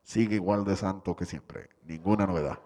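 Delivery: loud, low walkie-talkie guard voice; radio report. Acted.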